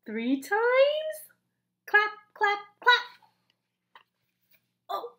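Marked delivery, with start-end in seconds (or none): 0.1-1.2 higher, louder gasping question; amazed gasp. Acted. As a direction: marvel at it.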